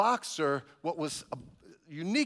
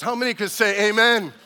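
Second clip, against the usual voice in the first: loud, rising call to the congregation; rally the crowd with a shout; preacher's call-out; 4 semitones higher, 9 dB louder than usual. Real, not acted. Preacher calls out to the church, loud rousing call.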